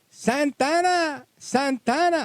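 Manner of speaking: slow, high, drawn-out call of Santana; yelling a name. Retold or imitated. Clerk calls the guard across the office, high and drawn out. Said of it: "this woman yells".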